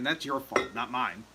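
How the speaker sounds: usual speaking voice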